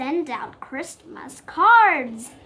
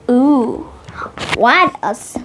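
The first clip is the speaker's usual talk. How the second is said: ordinary delivery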